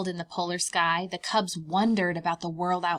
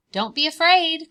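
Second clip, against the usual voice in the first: louder, higher call across the snow; 8 semitones higher, 10 dB louder than usual. Acted. Mama Bear calling cubs out of the den. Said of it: "called Mama Bear".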